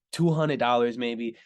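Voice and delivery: ordinary talk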